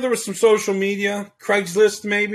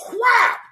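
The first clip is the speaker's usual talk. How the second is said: sharp shouted whap like a whip crack; whip crack sound; 4 dB louder than usual. Pretend.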